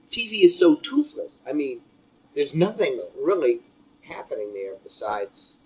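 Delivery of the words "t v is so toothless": scornful. Real, not acted. Scornful remark on TV.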